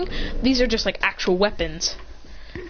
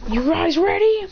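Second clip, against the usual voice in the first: pumped-up call; 8 semitones higher than usual. Acted. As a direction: hype the team up before the attack. Calling team to combo attack.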